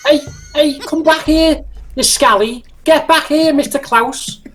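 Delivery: high-pitched, indignant Scouse commander; Scouser playing tanks. Scouse commander calling back runaway Klaus, mock indignation. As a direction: call after a deserter in hammed-up Scouse. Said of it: "a Scouser plays tanks".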